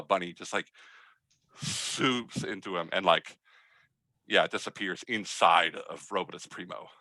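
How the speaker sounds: ordinary talk, nothing marked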